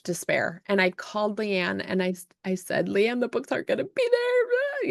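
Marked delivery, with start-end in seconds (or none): 2.9-4.9 high, wailing mock cry; a retold ugly cry pitched way up; imitating bawling. Author calling her friend Leanne in despair, mock wailing. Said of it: "I'm just bawling".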